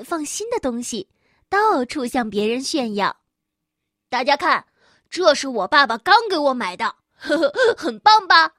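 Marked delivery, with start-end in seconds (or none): 4.1-8.5 boastful little kangaroo. Acted. Kangaroo brags to classmates.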